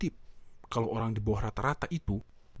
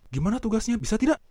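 questioning boss; 8 semitones higher, 5 dB louder, faster than usual. Shown as a demonstration. Boss asking about task.

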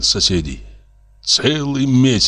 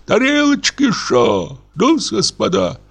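rowdy call; 12 semitones higher than usual. Acted. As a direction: shout orders like a merry drunk host. Drunk reveller calls the waiter.

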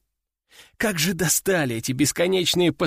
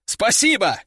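higher, eager thanks; cheerful thanks; 4 semitones higher than usual. A narrator's reading. Recruit thanks general for punishment, cheerful. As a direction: chirp it gratefully.

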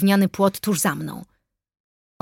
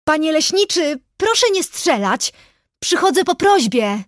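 high-pitched calling out; loud shout; 8 semitones higher, 4 dB louder than usual. A narrator's reading. Narrator begs the forester not to shoot, shouting. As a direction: shout a plea across the distance. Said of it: "krzyknęłam głośno".

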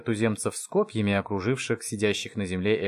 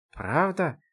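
higher-pitched surprised question; amazed; 7 semitones higher than usual. A narrator's reading. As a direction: ask it in mild amazement. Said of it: "поразился его старший брат". (удивлённо)

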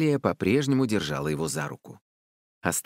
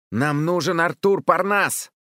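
high, loud declaration; called-out demand; 8 semitones higher, 5 dB louder than usual. A narrator's reading. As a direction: call it out firmly to the spirit. Child calls demand to forest spirit. (громкое требование)

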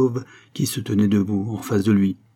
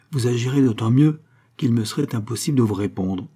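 ordinary delivery, nothing marked

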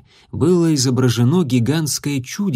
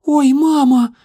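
much higher, louder startled squeak; frightened cry; 13 semitones higher, 6 dB louder, slower than usual. A narrator's reading. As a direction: yelp in fright. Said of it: "съёжилась Исси".

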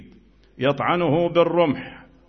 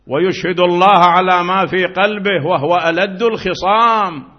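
preach; 5 semitones higher, 6 dB louder than usual. Acted. Recites verse loudly.

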